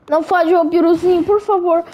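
whiny plea. Real, not acted. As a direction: beg the enemy in a high whine. Begging opponent mid-fight. (súplica chorosa, aguda e mais alta)

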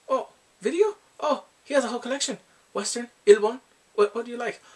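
loud, high and eager, rattling it off. Retold or imitated. Offering his tape collection, he gushes loudly to his friend. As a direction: brighten up and push it like a salesman.

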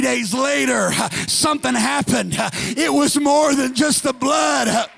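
loud, high, chanted sermon cadence; fiery preaching. Acted. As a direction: preach it hard to the congregation. Preaching at full force.

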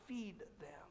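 a soft, intimate whisper of the command; whispered Feed them. Acted. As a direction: breathe the words out as a hush. Emphatic two-word climax, whispered.

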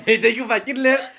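high laughing repeat. Real, not acted. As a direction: say it while cracking up. Repeating channel name, laughing.

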